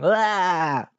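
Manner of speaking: high, drawn-out "aah" of revulsion; disgusted groan. Real, not acted. Recalling the jaw feeling of cartilage, disgusted cry. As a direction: let out a long squirming cry.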